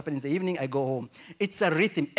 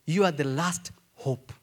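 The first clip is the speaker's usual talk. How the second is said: normal talking voice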